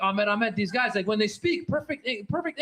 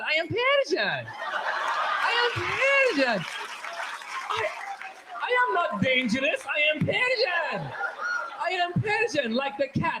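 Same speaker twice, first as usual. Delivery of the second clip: smiling Persian; 6 semitones higher than usual. Acted. Comedian plays Iranians reassuring they're not dangerous, smiling and sweet. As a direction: say it through a big harmless smile. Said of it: "we smile".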